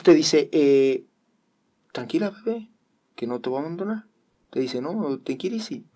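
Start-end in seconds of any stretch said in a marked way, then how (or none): 1.9-5.8 tender Jesus voice. Retold or imitated. Voicing Jesus answering Pili.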